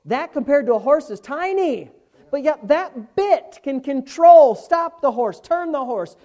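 energetic pulpit teaching; forceful preaching. Real, not acted. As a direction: drive the illustration home with hard-hit key words.

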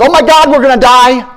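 hammed-up terror on the intercom; panicked captain. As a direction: play the captain losing it. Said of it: "the captain comes on and says".

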